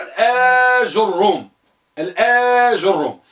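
الآجروم with long held آ and ju on ج; fourth vocalization. Shown as a demonstration. Teacher listing the fourth type, carefully drawn out. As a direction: stretch the آ of الآجروم. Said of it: "الرابع".

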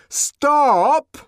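very high, drawn-out shout; ringing, full-voiced call; loud, ringing. A narrator's reading. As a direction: halt the whole crowd with one cry. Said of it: "in a loud and ringing voice".

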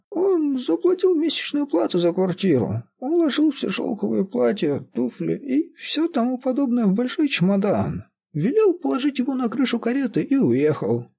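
put-on very high, plaintive old woman; whining old caretaker. A narrator's reading. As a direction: answer in a high, whiny old voice. Old woman answers Redman.

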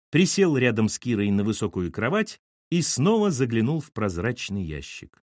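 ordinary delivery, nothing marked

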